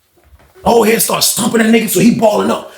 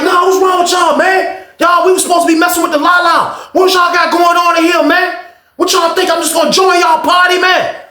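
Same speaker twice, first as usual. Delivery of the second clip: high, shouting challenge to the crowd; imitated indignant shout; 10 semitones higher than usual. Beach shouting at the group.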